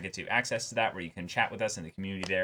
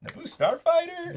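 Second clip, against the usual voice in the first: much higher, bright mock Cobb voice; 3 dB louder than usual. Host retells Cobb spotting the starfighter, high mock voice. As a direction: play Cobb as a giddy fan, voice jumped way up. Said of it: "Cobb's like".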